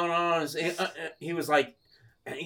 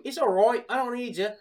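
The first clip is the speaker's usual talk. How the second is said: higher-pitched mock English bloke; English accent; 7 semitones higher than usual. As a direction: brush them off in a put-on English accent. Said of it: "like, an English guy".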